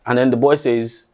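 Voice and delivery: usual speaking voice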